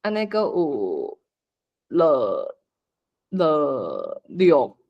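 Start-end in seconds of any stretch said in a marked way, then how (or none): none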